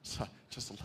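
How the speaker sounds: soft, low, settle-down voice; hushed calming. Acted. Preacher soothing over-eager goal setters, hushed calming. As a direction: bring it right down, soft and low.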